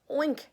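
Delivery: a high, loud oinking hog; hammed-up farmyard oink; pig oink. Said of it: "said the hog".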